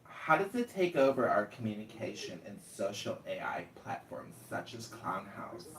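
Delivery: usual speaking voice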